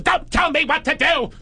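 high, indignant queeny squawk; mock campy tantrum. Friend snapping back at the comic, queeny. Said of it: "he starts getting Queenie".

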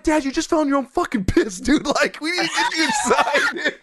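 very high, laugh-strained retelling; laughing exasperation. Retold or imitated. Son retells urging fallen dad inside, laughing exasperation. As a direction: squeal it through laughter. Retold plea while laughing.

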